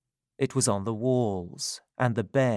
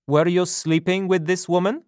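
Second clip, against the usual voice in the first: louder, blunt policeman's voice; london policeman; 7 semitones higher, 5 dB louder than usual. A narrator's reading. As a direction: question him like a hard cop. Policeman questions Jonathan.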